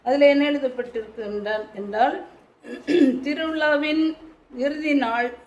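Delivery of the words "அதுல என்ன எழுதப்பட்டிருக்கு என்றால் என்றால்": normal talking voice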